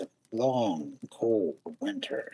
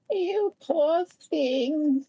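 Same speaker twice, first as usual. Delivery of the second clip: high, slow, squeaky little mouse; field mouse voice; much slower than usual. A narrator's reading. Narrator voicing the field mouse welcoming Thumbelina, high and slow. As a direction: voice the kindly field mouse, pitched way up. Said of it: "said the field mouse".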